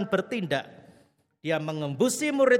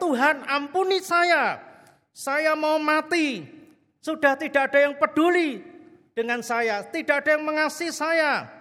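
desperate crying out; 8 semitones higher, 5 dB louder than usual. Retold or imitated. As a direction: cry it out as if you want to die. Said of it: "Dan dia berteriak-teriak". (jeritan putus asa, tinggi dan keras)

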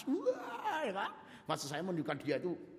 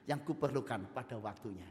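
ordinary talk, nothing marked

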